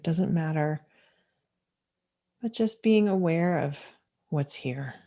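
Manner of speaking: unhurried meditation guidance with long pauses; calm, measured mindfulness teacher leading a practice; guided meditation. Acted. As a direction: guide listeners gently into stillness, leaving space between phrases.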